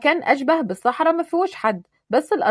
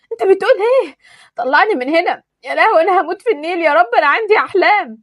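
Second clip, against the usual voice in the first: higher, louder frantic wailing; panicked pleading; 7 semitones higher, 5 dB louder than usual. Acted. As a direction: beg to be pulled out. Misk panicking in the water.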